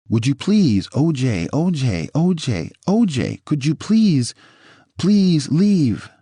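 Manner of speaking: read-out desperate plea, rising in pitch; pleading. A narrator's reading. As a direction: implore him, pitch climbing with each repeat. Reads out Nicole pleading with him.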